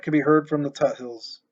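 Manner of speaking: plain reading voice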